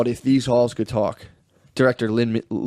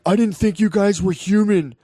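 high-pitched, overwrought confession; mimicking a guilt-ridden confessor; 7 semitones higher than usual. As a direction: plead a mock apology in a raised voice.

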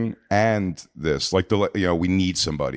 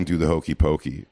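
ordinary talk

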